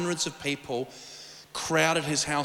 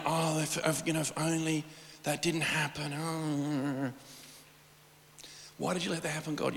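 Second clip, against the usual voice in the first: mock sulky moan; 6 dB quieter than usual. Retold self-pitying sulk.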